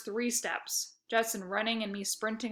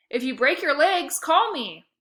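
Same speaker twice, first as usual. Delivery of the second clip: eager, higher and louder pitch; 5 semitones higher, 6 dB louder than usual. A narrator's reading. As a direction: sell it eagerly. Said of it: "I said".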